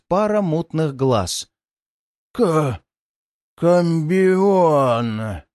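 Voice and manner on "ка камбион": wheezing demon voice; creeping nightmare whisper. Acted. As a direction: rasp it out like a waking monster. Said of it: "прохрипело существо на языке демонов".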